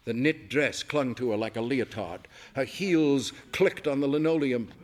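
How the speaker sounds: ordinary delivery, nothing marked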